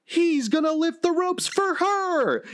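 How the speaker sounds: very high, drawn-out commentator hype; hammed-up climactic call, stretched at the end; awestruck announcers. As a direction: imitate announcers bursting with amazement. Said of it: "they're like".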